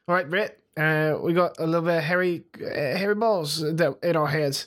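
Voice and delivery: a hint of a New Zealand accent, lightly put on; mock Kiwi accent. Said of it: "let me fly to the Concords it up".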